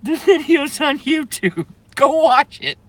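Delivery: high, wheezing through laughter; cracking up while urging viewers; laughing fit. Real, not acted. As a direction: squeeze it out mid giggle fit.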